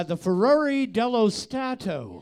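unrolled, everyday English-speaker accent; regular English accent. Shown as a demonstration. Co-host repeats the railway name on request, regular English accent. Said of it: "with a regular English accent".

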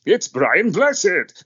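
high, slow, booming theatrical voice; booming Brian Blessed. Retold or imitated. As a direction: boom it out like a grand actor.

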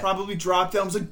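normal talking voice

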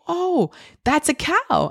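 put-on high, slow, sing-song toddler talk; parentese to a toddler. Mom showing her toddler a magnet cow, high slow parentese. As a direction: coo it slowly like naming a toy for a baby.

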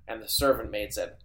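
usual speaking voice

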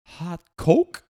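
loud, high, questioning imitation; halting high-pitched query; struggling non-English speaker. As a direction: imitate a tourist trying out foreign words. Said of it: "can't speak a word of English".

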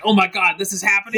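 high, excited wonder; thrilled like a starstruck fan; giddy disbelief. Retold or imitated. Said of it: "He's like".

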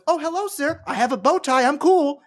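mock polite voice. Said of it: "So the thing I get to go is".

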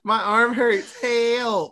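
high whiny complaint; hammed-up crybaby; mock whine. Co-host mocking Tetsuo whining, high. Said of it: "mocking Tetsuo's whining".

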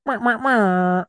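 high, drawn-out "mwah" noises; high mocking wah-wah sound; mock horn noise. Mock noise after [name].

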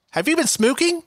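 high, odd Southern lilt on smoking; imitating her accent. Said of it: "How she says it is".

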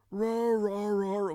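big monster roar, high and loud; huge fiery dragon roar. Pretend. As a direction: roar like Godzilla. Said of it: "Godzilla will say".

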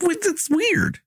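high-pitched, puzzled protest; baffled reaction. Retold or imitated. Retelling own baffled reaction.

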